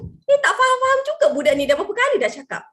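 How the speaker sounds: high, loud, exasperated scolding; fed-up mother's rant; exasperated mum. Retold or imitated. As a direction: nag a child who won't listen.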